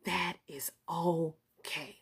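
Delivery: breathy whisper, slowed; whispered aside. Real, not acted. Whispering to viewers that it's okay. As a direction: whisper it like a secret reassurance. Soft aside in motivational talk.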